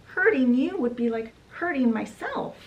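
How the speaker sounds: high-pitched, mildly overplayed insistence; acted protestation. Mother assures daughter she could never hurt her, high acted protest.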